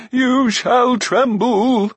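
very high, loud, slow giant's threat; quavering cry. A narrator's reading. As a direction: stretch every word into a wobbling cry. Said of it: "the quavering voice cried again".

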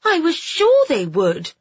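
put-on high, loud upset girl; pleading in distress; rising distress. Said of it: "the distress in her voice rising".

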